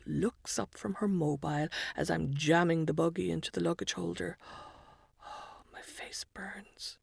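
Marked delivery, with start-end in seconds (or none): 5.2-7.0 hushed, mortified whisper; embarrassed whisper. A narrator's reading. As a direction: breathe it out in shame. Embarrassed aside on the bus.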